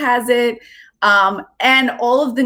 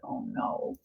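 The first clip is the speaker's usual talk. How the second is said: hushed oh no; 17 dB quieter than usual. Pretend. Aside reacting to the story.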